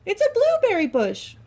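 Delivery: a touch of the excited Bear; bright proud claim, a bit high and loud; lightly voiced Bear. Bear character voiced in story.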